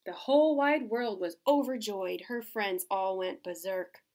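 lively storybook voice with character turns; reading to children. Acted. Reading Otis cheering Thelma on, storybook voice. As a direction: bring Otis and Thelma to life.

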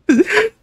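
a sudden high laugh; real laughter; high burst of laughter. Real, not acted. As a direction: crack up at the memory. Bursts out laughing at fire story.

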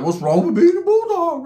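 high-pitched mock-offended question; mock protest. Narrator challenges Smokey over the Bulldog insult, mock protest. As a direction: squeal it in mock indignation.

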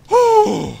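high rush of relief, breath out; relieved whoosh. Real, not acted. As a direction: blow out a high relieved whew. After escaping danger.

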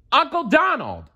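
high nephew shout; shouting to uncle. A narrator's reading. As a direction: call out across to him, warning. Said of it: "Dewey shouted".

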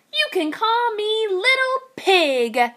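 high proud piglet; high proud little pig. Acted. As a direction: say it proudly, small voice.

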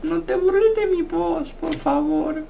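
mock-hurt little kid; fake kid voice. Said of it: "Debes hacer una voz fingida".

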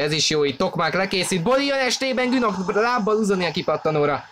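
rising to a near shout at the chance; yelling the play as it unfolds; excited hungarian sports commentary. Real, not acted. Goal chance in live commentary. (izgatott sportközvetítés)

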